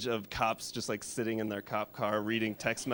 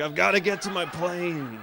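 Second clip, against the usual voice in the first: put-on high, slow cranky old man; old man grumbling; 7 semitones higher, 3 dB louder than usual. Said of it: "It's like".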